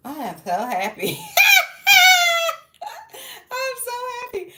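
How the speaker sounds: laughing shout into a very high, loud squeal; drugged giddy laugh. Retold or imitated. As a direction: giggle it out like the sedative just hit. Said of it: "and I was just like".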